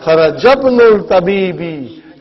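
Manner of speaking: "Kharajabnul" slowly run together as one word; linked pronunciation. Shown as a demonstration. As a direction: join "Kharajabnul" with no i at the start of "ibn". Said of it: "What will you pronounce?".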